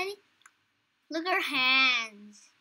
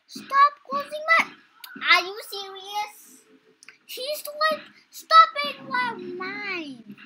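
frustrated yell; 8 semitones higher, 5 dB louder than usual. Real, not acted. Frustrated at other player.

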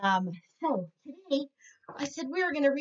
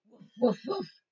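mock bark. Dog barks in story.

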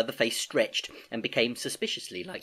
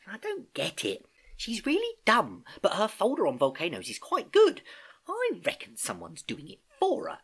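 put-on gossipy girl, climbing higher as she grumbles; chattering schoolgirl niece; 4 semitones higher than usual. Niece telling uncle about classmates' projects, gossipy. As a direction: prattle on, then turn indignant and high.

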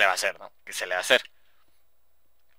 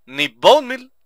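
high, loud goofy voice; mock whiny outburst; silly put-on voice; 6 semitones higher, 10 dB louder, much slower than usual. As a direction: squeak it out like a cartoon. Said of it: "Hablé como pinche acá".

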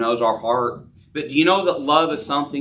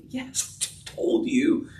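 unvoiced, mumbled reply; wife brushing off her husband, mumbled; muttered brush-off; 5 dB quieter, slower than usual. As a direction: wave it off under your breath. Wife's offhand brush-off.

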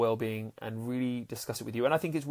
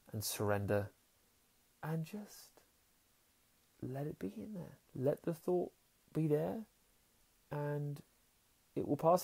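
soft; 3 semitones higher, 8 dB quieter than usual. Real, not acted. Calming guidance, soft.

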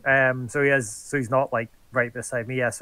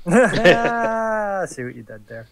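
laughing; 5 semitones higher, 5 dB louder, slower than usual. Real, not acted. Laughing at a pun.

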